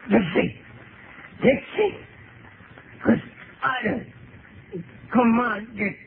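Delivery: high, frantic cries for help; desperate calls. Acted. Terrified man calls out to Dixie for help. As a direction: scream the name into the dark.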